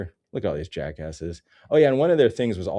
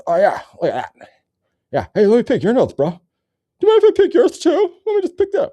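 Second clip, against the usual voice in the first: high, loud goofy put-on voice; mock nose-picking billionaire; 11 dB louder than usual. Mocking billionaires as goofy nose-pickers, high silly voice. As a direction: squeal it like a silly rich guy picking his nose.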